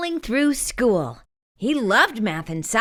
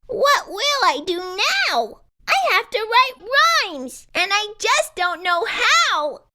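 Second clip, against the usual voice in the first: put-on higher, slow, anxious young voice; worried Ricky; 11 semitones higher than usual. A narrator's reading. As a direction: fret to your friend.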